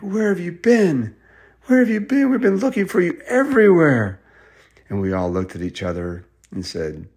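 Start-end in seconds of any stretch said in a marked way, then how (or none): 0.0-4.2 high, urgent questioning; a mix of worry and relief; anxious relief. Acted. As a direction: demand where they've been. Relieved family finding the kids.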